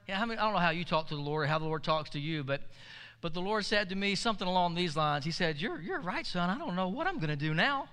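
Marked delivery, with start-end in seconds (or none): 5.6-7.9 sarcastically playing helpless; sarcastic. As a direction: feign being at a loss, dripping sarcasm. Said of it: "You're being sarcastic with me".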